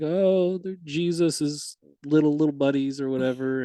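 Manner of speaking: high, mocking sing-song; mock the churchy view. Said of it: "where angels are like".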